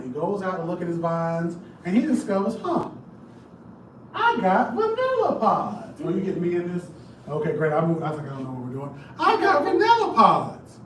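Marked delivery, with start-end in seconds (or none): 2.6-6.0 surprised master. Retold or imitated. Master discovers pods, voiced. 9.2-10.8 surprised master. Retold or imitated. Repeating master's surprised line.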